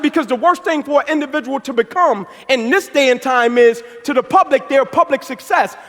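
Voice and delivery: loud, high, driving motivational preaching; fired-up preaching. Acted. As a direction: preach it to the crowd, building. Builds to preaching intensity.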